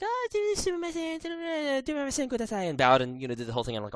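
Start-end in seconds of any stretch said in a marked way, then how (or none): 0.0-2.7 very high, sweet pleading clerk voice; polite shop girl. Retold or imitated. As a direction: squeak out a polite apology and plea. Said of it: "the girl was just like".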